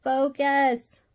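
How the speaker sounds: a real exasperated shout; telling the camera off, loud and high; loud command. Real, not acted. Scolding the camera focus.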